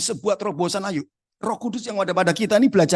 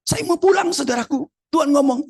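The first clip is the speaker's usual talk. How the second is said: ordinary delivery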